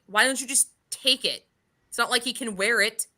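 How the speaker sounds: usual speaking voice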